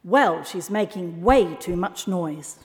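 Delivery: ordinary delivery, nothing marked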